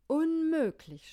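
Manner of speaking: high, loud, slow cry of dismay; shouted. A narrator's reading. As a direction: throw the word out in alarm. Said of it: "schrie er". (hoher, lauter, langsamer Aufschrei)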